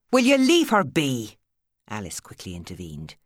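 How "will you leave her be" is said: loud, high Irish protest; sharp Irish cry. A narrator's reading. Alice stopping Steve bullying Maddie, loud Irish cry. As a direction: cut in to stop him, loud.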